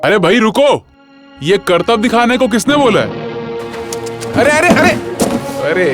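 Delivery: high-pitched, exasperated telling-off; rising alarm at an accident about to happen; alarmed scolding. Acted. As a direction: call out to stop a careless worker. Supervisor halts careless worker, alarmed.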